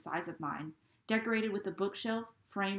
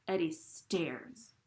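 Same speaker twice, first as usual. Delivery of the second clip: ordinary delivery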